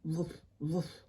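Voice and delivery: low, soft pretend woofs; dog barking. Thirsty dog woofs beside its owner, soft low pretend barking. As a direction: imitate a dog with small, low barks.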